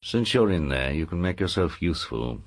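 low, soft, menacing confidential voice; undertone. Acted. Lord to his hidden niece by the wardrobe, in an undertone. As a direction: speak secretly under your breath, pitched well down. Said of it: "spoke in an undertone".

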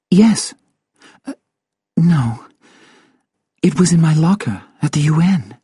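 high, hesitant, rattled woman; uneasy answer with halting pauses; shaken. Acted. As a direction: correct yourself nervously, pitched well up. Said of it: "She was shaking".

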